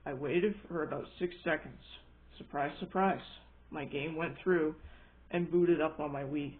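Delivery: ordinary delivery, nothing marked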